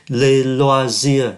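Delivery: syllable by syllable "Les loisirs", a Z in the middle; slow French demo. Shown as a demonstration. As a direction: spell out "Les loisirs" very slowly, with the S sounding like Z. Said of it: "Ký tự S này phát âm là Z". (đọc mẫu "Les loisirs" thật chậm, S đọc thành Z)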